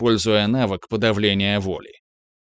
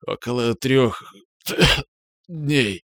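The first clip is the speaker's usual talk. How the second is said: coughing up blood; 3 semitones higher, slower than usual. A narrator's reading. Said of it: "Он сплюнул кровь".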